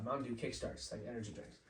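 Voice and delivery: normal talking voice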